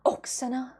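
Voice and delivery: ordinary talk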